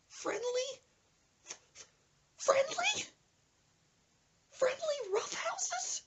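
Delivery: very high disbelieving squeal; high falsetto disbelief, slow on the last; incredulous. Retold or imitated. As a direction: echo the word in shocked falsetto. Incredulous echo of friend's words.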